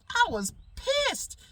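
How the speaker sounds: high and slow, stretching pissed; drawn-out indignation. Retold or imitated. Repeating to his audience how angry he was, slow and high. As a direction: drag the word out in disbelief.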